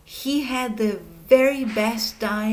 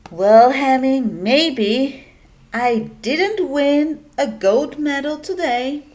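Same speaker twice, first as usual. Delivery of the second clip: proud; 3 semitones higher, 7 dB louder than usual. Acted. Boomer addresses crowd proudly.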